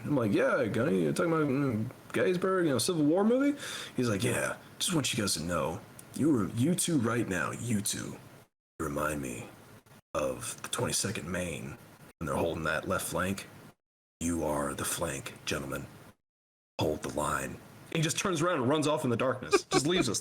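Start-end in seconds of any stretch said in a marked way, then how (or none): 0.3-3.6 high-pitched questioning voice; acted confusion, rising to a high question; puzzled reply. Acting his puzzled reply to Gunny. 4.2-17.6 low, hushed dramatic speech with long pauses; solemn Gunny. Retold or imitated. Acts Gunny's solemn speech before leaving.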